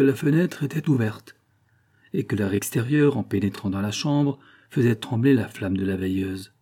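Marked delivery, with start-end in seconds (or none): none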